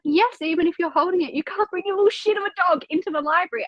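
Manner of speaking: high-pitched, exasperated telling-off; rising mock rant, rattled off in a high voice; exasperated retort. Librarian arguing with dog owner, exasperated high retort.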